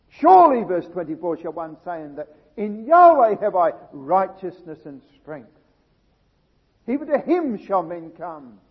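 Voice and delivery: loud peaks on Yahweh and strength; preaching scripture. Acted. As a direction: proclaim it to the congregation. Preacher reads scripture with force.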